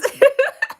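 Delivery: loud high delighted laugh; burst of laughter. Real, not acted. To viewers praising her accent, loud high real laughter.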